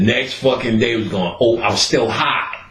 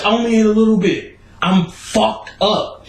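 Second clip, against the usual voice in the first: 1 panicky high whine; 10 semitones higher than usual. Retold or imitated.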